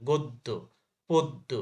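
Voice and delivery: গদ্য, পদ্য said god-do, pod-do; doubled দ. Shown as a demonstration. Teacher gives students examples, গদ্য and পদ্য with doubled d. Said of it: "দ double হয়েছে".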